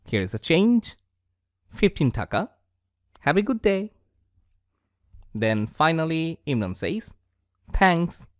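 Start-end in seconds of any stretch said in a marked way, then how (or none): none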